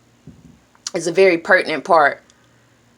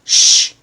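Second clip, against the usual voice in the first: shushing; 5 dB louder than usual. Shown as a demonstration. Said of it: "I say, "Hey".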